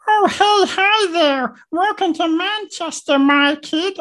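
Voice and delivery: chirpy little red monster greeting you; Elmo impression. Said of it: "I can do a very good impression of ... Elmo".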